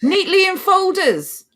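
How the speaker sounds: high, drawn-out mock wonder; exaggerated, incredulous singsong; overdone sarcasm. Real, not acted. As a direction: lay the sarcasm on thick, slow and high. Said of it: "did I overdo the sarcasm there".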